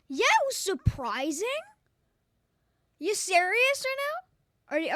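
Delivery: loud, high incredulous outburst; shocked disbelief. Real, not acted. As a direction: blurt it out in disbelief. Disbelief at clip.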